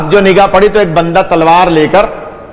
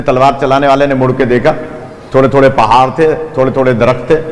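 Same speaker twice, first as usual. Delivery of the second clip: ordinary talk, nothing marked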